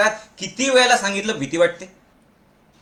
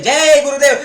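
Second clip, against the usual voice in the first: high, loud, energetic greeting; enthusiastic person; 7 semitones higher, 6 dB louder than usual. Shown as a demonstration. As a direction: belt out the greeting with zest. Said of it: "उत्साही माणूस कसा बदलेल". (उत्साही, मोठ्या आवाजात)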